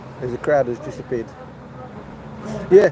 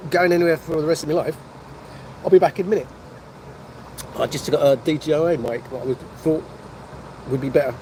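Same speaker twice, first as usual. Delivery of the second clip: usual speaking voice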